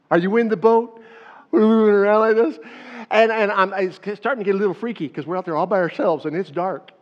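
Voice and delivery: loud, high, animated telling; excited storytelling. Acted. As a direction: pull them into the boat with energy. Excited storytelling, freaky lake.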